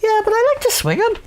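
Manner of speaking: squeaky high voice, playful; high little girl voice. Retold or imitated. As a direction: pitch way up like a tiny girl.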